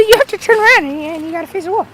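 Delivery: high put-on little-boy voice; bossy kid. Cousin orders him to face the wall, high bossy voice.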